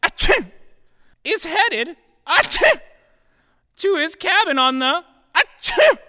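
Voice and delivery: very high squeaky voice broken by sneezes; sneezing dwarf voice. A narrator's reading. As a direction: squeak it out between loud sneezes. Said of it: "Sneezy exclaims".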